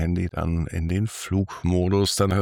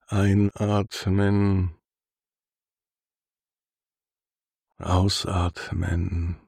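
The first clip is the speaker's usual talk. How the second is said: sultry narrator. Acted. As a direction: lull the listener toward sleep, unhurried. Guided body scan for sleep.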